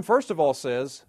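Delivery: ordinary talk, nothing marked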